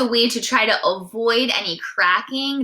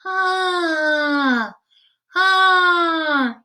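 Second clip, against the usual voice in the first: a higher "Ah" with only a little air through the nose; slightly nasal ah; 7 semitones higher than usual. Shown as a demonstration. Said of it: "try a little bit less out of your nose".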